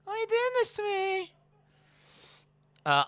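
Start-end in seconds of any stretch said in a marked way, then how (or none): 0.0-1.3 very high squealed plea; Mandy Rose impression. Host mimicking a floored wrestler, squealed mock plea. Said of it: "just Mandy Rose on the floor going".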